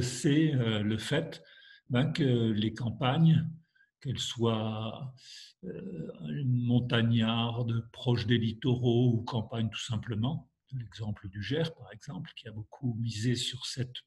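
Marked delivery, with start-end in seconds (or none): none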